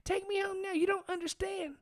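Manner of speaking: put-on very high hysterical girl voice. Mocking the frantic girl demanding a ride home, high squeal. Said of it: "And she's like".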